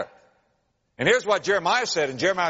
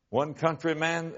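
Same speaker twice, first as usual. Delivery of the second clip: ordinary delivery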